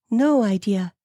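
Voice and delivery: high-pitched female character voice; reply high, as the wife; high, put-on woman's voice; puzzled wife. A narrator's reading. Amelia answers questioning husband.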